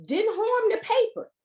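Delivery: high, amazed exclamation of disbelief; can't believe the paper survived, said in astonishment. Real, not acted. Amazed at the burn outline.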